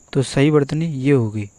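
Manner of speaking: ordinary talk, nothing marked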